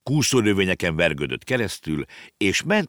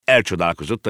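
usual speaking voice

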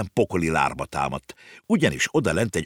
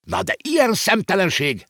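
very high, scandalised shriek; little landlord's shrill indignation; outraged screech; 13 semitones higher than usual. A narrator's reading. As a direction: screech it in offended disbelief. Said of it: "rikálcsolt a kiskövér Waffelbruck úr".

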